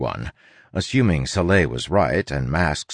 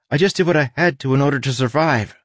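higher, hurried self-defence; defensive Tress; 8 semitones higher, 3 dB louder, faster than usual. Acted. Tress defends herself to the studying crew, defensive. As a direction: rush out an excuse in a higher voice.

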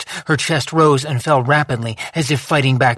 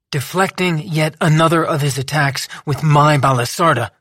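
ordinary delivery

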